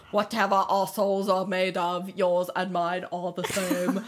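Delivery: slow, hammed-up British accent; mock a brooding British line reading, drawn out; Hardin. Mimicking how he talks in the movie, slow mock British accent. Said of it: "this is honestly how he talks in the movie".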